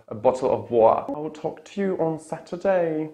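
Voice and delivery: hammed-up British accent with glottal T; mock British accent. Shown as a demonstration. Teacher making fun of it a little, mock British. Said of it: "I'm just making fun of it".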